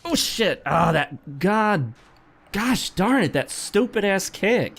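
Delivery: high, exasperated swearing at the game; frustrated outburst. Real, not acted. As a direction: blow up at the stupid kick. Dies from an accidental kick.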